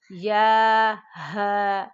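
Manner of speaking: slow demonstration of ہا; heavy throaty ha. Shown as a demonstration. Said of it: "یہ بھی بھاری آواز میں پڑھیں گے".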